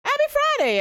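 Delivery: high sing-song happy friday; sing-song greeting. Real, not acted. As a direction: call it out up high like a jingle. Streamer greets chat, sing-song.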